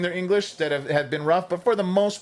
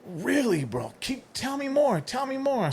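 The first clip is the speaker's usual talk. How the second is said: high-pitched fake eagerness; mock-fascinated, pitched up; feigned interest; 11 semitones higher, slower than usual. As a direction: egg the caller on, playing keen. Feigned interest to cold caller.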